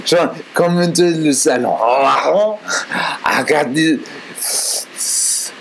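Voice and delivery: put-on hammy French accent, drawn-out calling; old-time French dude. Old-time Frenchman calling Jean into the salon, exaggerated French accent. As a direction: summon a friend in cartoon French accent, stretching the words. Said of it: "some old-time French dude was like".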